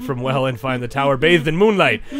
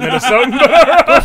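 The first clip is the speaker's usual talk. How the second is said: laughing; 11 semitones higher, 9 dB louder, slower than usual. Real, not acted. Laughing at bad accent.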